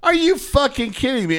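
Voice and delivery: high, exasperated disbelief; mock-outraged high voice; retold incredulity. Retelling an incredulous reaction.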